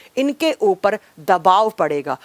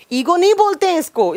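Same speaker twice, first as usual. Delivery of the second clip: raised, higher-pitched rebuke; annoyed scolding; 8 semitones higher than usual. Real, not acted. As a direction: snap back at the students, pitch way up. Teacher scolds students over comments. (चिढ़कर ऊँची आवाज़ में डाँटना)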